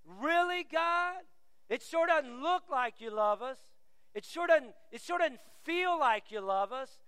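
sceptical, challenging backtalk; teenage attitude. Acted. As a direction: doubt it aloud like a sulky teenager. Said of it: "that's their response".

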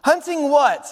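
high, inquisitive little voice; Piglet. Acted. Piglet presses Pooh, high questioning voice. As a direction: ask it high, falling off at the end. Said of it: "So Piglet said".

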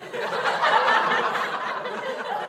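high, airless burst of laughter; breathy laugh. Real, not acted. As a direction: laugh at the memory of the bad pick. Mocking Trubisky pick.

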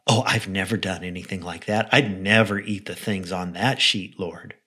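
low, soft self-righteous protest; mock a smugly pious denial, quietly; Peter's self-righteous pride. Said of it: "hear the self-righteous pride in his voice".

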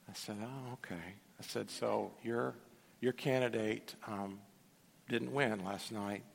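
a low, soft, careful reply; gentle and soft. Retold or imitated. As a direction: ease into it as if calming a shaken friend. Gently answering an upset believer.

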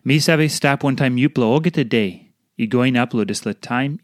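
ordinary talk, nothing marked

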